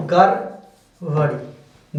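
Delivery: drawn out very slowly, syllable by syllable; slow, clear dictation pace. Shown as a demonstration. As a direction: stretch गड़बड़ी out slowly for writing. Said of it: "देखिए, गड़बड़ी".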